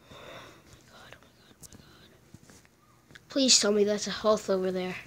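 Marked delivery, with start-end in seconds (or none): 0.1-3.3 barely audible, slow whisper; breathless whisper. Real, not acted. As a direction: whisper it in dread. Tense moment in game.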